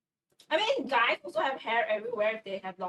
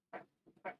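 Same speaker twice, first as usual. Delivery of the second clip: whispered crap; 14 dB quieter than usual. Real, not acted. Muttering under breath while baking.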